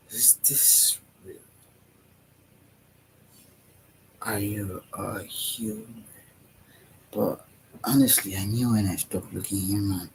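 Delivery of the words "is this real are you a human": staring onlooker. Retold or imitated. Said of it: "looking at you like".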